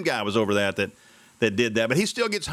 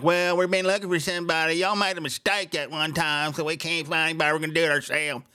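mocking quote; 5 semitones higher than usual. Mocking Ken Anderson's excuse.

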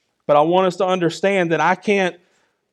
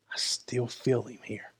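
hushed; 7 semitones lower, 14 dB quieter than usual. Real, not acted. Intimate testimony, very soft.

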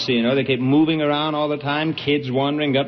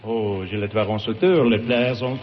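lower, mock-French patter; French accent; 3 semitones lower than usual. [name] answers about the continent, comic French voice. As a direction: burble like a stage Frenchman. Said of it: "It is La Belle France".

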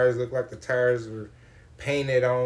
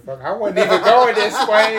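frustrated outburst; 8 semitones higher, 11 dB louder than usual. Retold or imitated.

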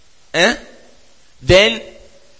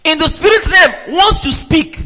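preached shout; 8 semitones higher than usual. Real, not acted. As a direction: hammer the point home to the congregation.

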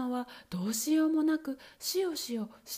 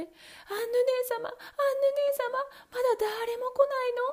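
high, anxious call; wife calling up to her sister; 13 semitones higher, 5 dB louder, faster than usual. Acted. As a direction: cry up to the tower.

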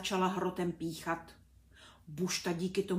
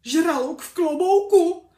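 high, loud, panicked shriek; 8 semitones higher, 16 dB louder than usual. A narrator's reading. Teacher shrieks at a hat he thinks is a shark. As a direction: scream it in blind terror. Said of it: "zaječel Bušta".

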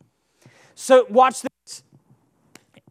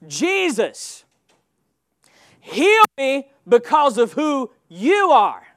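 mildly raised, pleading cry; 2 semitones higher, 4 dB louder, slower than usual. Acted. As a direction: call out to Jesus, pressing on "heal me". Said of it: "Bartimaeus is crying out".

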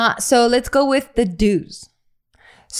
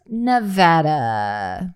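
normal talking voice

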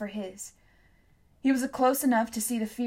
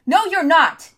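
high, loud shout; shouted denial; 8 semitones higher, 13 dB louder than usual. A narrator's reading. Tom shouts at Hester, loud denial. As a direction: fling it straight back.

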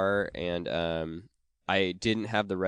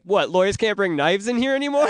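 high, loud mock-indignant lawyer; hammed-up outraged lawyer with a knife; 10 semitones higher, 7 dB louder than usual. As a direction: play up his offended disbelief. Pimp-suited lawyer's indignant quip.

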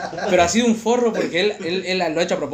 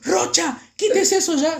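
high-pitched imitation of an angry teacher; scolding teacher; 11 semitones higher, 3 dB louder, slower than usual. English teacher catching Rocha misbehaving, high scolding. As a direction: snap the order in a high teacher voice.

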